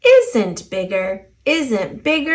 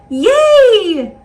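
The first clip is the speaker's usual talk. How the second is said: loud, high cheer; shout; 10 semitones higher, 7 dB louder than usual. A narrator's reading. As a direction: cheer out in joy. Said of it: "Miss Shimmer shouted".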